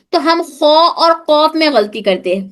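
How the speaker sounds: ordinary delivery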